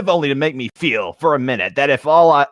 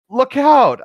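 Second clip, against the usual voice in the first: a higher, louder mock warning cry; girl shouting; 11 semitones higher, 6 dB louder than usual. Girl shouting a warning at the shark boy, higher mock yell. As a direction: yell look out like a startled kid. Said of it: "the girl shouted".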